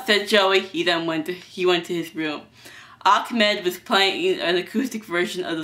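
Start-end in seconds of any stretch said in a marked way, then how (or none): none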